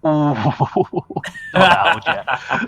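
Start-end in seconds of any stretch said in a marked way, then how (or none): 0.0-0.1 long drawn-out "ooh" breaking into laughter; teased, laughing "oooh"; mock-wounded ooh. Real, not acted. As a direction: react to a cheeky jab with a stretched ooh. Mock offence at middle-age jibe.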